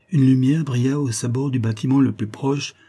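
ordinary delivery, nothing marked

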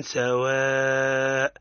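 drawn-out سواء with long madd; tajweed recitation. Shown as a demonstration. Teacher modelling سواء for the student, slow recitation. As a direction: stretch سواء slowly with nasal ghunna. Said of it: "تقرأ هكذا".

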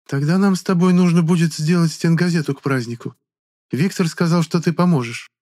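high, sugary flirty lady; flirtatious woman.

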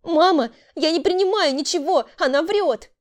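high, loud plea of innocence; desperate protest. A narrator's reading. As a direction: cry out against the accusation. Accused girl protests to her mother. (отчаянно протестовать)

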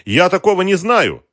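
high, loud, frightened protest; strained denial. A narrator's reading. Captive denies knowing the named man, high and strained. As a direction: insist on it, pitched right up. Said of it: "выдохнул Вадим".

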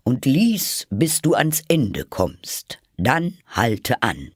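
slow, weighty royal order; earnest command. Acted. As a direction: instruct gravely, stressing each step.

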